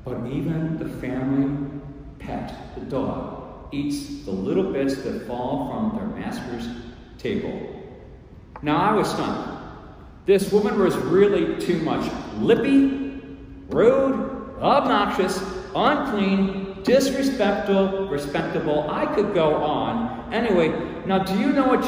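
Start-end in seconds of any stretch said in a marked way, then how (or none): 0.0-7.6 soft, low, unhurried reply; incredibly calm. Acted. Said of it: "in this incredibly calm voice". 12.4-18.9 high, emphatic scorn; indignant listing. Acted. Indignant apostle lists her faults.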